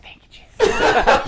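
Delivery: high, slow, breathy laughing relief; half-laughing mock thanks to Jesus, high and slow; relieved mock prayer. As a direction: sigh it out like rescued at last. Client relieved at being held.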